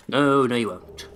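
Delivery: usual speaking voice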